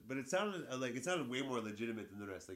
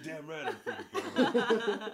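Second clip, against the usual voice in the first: higher, louder, slow laughing swagger; hammed-up triumphant echo of the punchline; laughing mock bravado; mock cocky line; 10 semitones higher, 10 dB louder, slower than usual. Friend mocks the story line.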